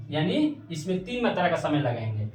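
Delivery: ordinary delivery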